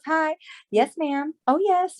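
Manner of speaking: high-pitched professional phone-call voice; fake phone voice. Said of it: "You know, that fake voice".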